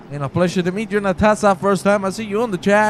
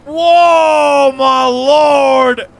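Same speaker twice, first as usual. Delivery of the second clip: loud, high commentator exclamation; astonished outcry; 6 semitones higher, 7 dB louder, much slower than usual. Real, not acted. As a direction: cry out in shock at the crash. Commentator stunned by crash replay.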